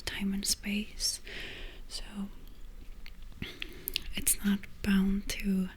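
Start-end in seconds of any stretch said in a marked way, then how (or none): none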